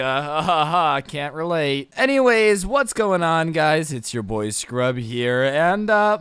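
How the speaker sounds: plain reading voice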